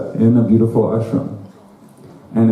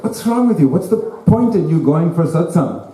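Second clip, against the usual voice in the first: much higher, indignant wife; exasperated scold; 7 semitones higher than usual. Retold or imitated. Wife scolding her husband for noticing nothing, exasperated. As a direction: scold him as the fed-up wife.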